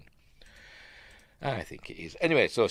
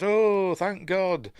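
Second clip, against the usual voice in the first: high, hammed-up relieved cry; mock relief; 10 semitones higher, 3 dB louder, much slower than usual. Woman to policeman after a scare, mock relieved. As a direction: let out relief in a put-on higher voice.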